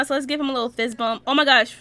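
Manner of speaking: normal talking voice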